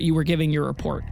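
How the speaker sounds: usual speaking voice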